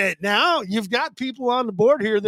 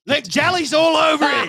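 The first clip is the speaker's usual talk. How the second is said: ordinary talk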